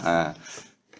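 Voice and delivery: ordinary delivery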